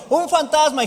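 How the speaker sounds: imitating a panicked shout; mock alarmed cry. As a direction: cry out in hammed-up fright. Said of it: "Yo no creo que Jesús les haya dicho".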